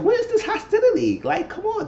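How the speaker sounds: retold disbelief at the mom's hostility, high and loud; incredulous outburst. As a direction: shriek it in disbelief at the screen. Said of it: "But I said".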